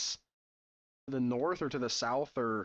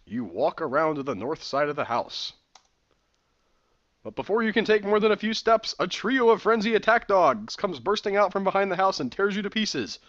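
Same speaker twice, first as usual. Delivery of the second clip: louder, building to the attack; dramatic game narration; 6 semitones higher, 9 dB louder than usual. Acted. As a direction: read the dog attack with rising drama.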